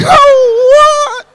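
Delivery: very high, loud fake wail; put-on crying. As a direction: burst into exaggerated mock sobs. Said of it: "I'm gonna cry now".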